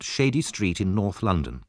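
ordinary delivery

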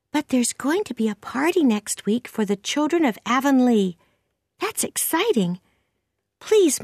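put-on high-pitched girl's voice, pleading at the end; high young voice, building to excitement; eager young Anne. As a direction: chatter up high as an eager girl.

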